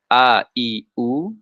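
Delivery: short clear A, I, U vowels; vokal pendek a i u. Shown as a demonstration. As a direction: sound each short vowel A, I, U crisply. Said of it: "semua huruf yang mutaharrik atau berharokat". (bunyikan a, i, u pendek dan jelas)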